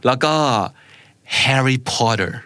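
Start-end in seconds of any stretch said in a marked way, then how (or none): 1.4-2.3 HARRY POTTER as Herry Podder; American vowels in "Harry Potter"; American pronunciation. Shown as a demonstration. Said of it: "it sounds so fake".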